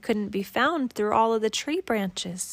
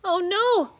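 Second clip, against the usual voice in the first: high, louder cry of dismay; cried out; 9 semitones higher, 5 dB louder than usual. Acted. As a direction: cry out as your friend slips away. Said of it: "they both cried".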